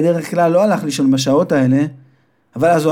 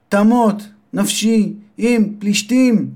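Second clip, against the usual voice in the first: crying out; 6 semitones higher, slower than usual. A narrator's reading. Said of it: "וזעק".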